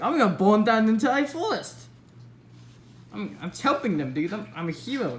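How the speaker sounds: mock deluded hero. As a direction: announce it like a proud, misguided hero. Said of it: "Soldier's just like".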